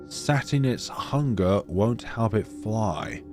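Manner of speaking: ordinary talk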